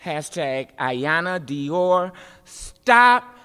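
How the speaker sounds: rhythmic performance poetry with big swings; spoken word poem. Acted. Poet performing for a crowd about injustice, rhythmic and rising.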